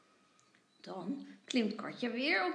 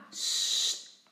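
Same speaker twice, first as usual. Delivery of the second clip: loud shush in reply; shushing back; 4 dB louder than usual. A narrator's reading. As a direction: echo the hushing sound. Little brother copies the shush.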